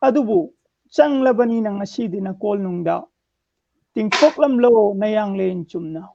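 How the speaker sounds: measured declamation in separate phrases; strong line openings, falling cadence; poem recitation. Acted. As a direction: recite verse line by line with pauses. Recites own poem on request.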